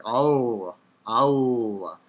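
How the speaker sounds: OH as a drawn-out r melting into w; word-final modern Hebrew r like a w. Shown as a demonstration. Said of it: "sounds just like a W".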